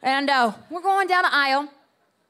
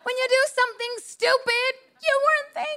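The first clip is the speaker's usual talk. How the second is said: much higher, stretched emphasis; emphatic punchline; 12 semitones higher than usual. Acted. Pastor driving home a lesson to the church, high and emphatic. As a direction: land the point. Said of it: "punchline delivery".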